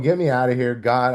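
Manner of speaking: high, pleading prayer to God; foxhole prayer. Retold or imitated. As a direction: beg God in a panic. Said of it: "alcoholic foxhole prayer".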